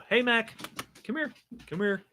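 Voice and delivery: high, soft coaxing call to a dog; talking to a dog. Real, not acted. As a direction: coax the puppy over sweetly. Calling the dog over.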